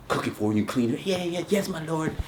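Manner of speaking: soft, meek mock obedience; submissive wife, mock. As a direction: say it like a dutiful servant. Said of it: "wife obeying husband".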